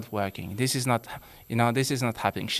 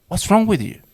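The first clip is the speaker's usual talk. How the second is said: loud, higher, blaming snap at the wife; accusing husband; 7 semitones higher, 8 dB louder than usual. Retold or imitated. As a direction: fire the question at her like an accusation. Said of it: "the first thing we say is".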